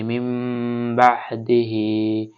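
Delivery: মিম বাহদি with ন turned to a held nasal ম; iqlab with meem. Shown as a demonstration. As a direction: hum the meem before বা. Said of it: "মিম ধরে পড়তে হবে". (ন সাকিন বাদ দিয়ে মিম ধরে গুন্নাহ)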